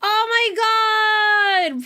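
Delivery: stretched-out shocked shout; gasp, then shout it slowly; surprised shout. Real, not acted. Raid arrives suddenly.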